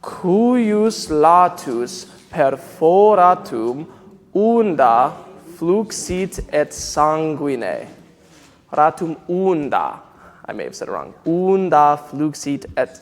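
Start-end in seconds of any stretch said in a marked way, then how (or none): 0.0-7.9 slow, measured Latin said with the choir; slow choral recitation. Shown as a demonstration. Director speaks the line with the choir, slow recitation. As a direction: lead them through the line slowly. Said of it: "Let's say it again".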